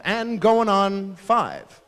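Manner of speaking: slow, emphatic drawn-out boast; imitating a child's excited addition; proud kid. Child boasting about age, slow and emphatic.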